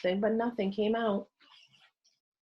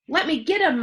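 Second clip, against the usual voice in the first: loud, higher eager plea; eager to fight; 8 semitones higher, 5 dB louder than usual. A narrator's reading. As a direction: beg to throw the first punch. Another eager boy.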